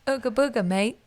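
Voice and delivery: light mock British accent, cheeky. Showing friend how it sounds, mock British accent. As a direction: toss off Ooga Booga in a jokey British accent. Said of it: "with a British accent".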